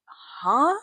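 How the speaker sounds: high, loud rising huh; startled huh. Retold or imitated. Driver reacting to an SUV in the air, startled. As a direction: blurt a confused huh, pitch shooting up. Acts out reaction to flipping car.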